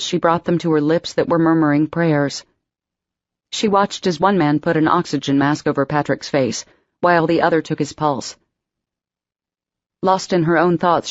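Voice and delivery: ordinary delivery, nothing marked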